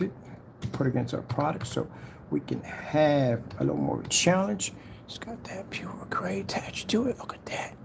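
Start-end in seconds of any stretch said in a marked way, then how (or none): 5.1-7.7 hushed, unvoiced whisper of admiration; admiring whisper. Real, not acted. Showing viewers the silver gray, whispering in awe. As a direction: whisper it as if the color takes his breath.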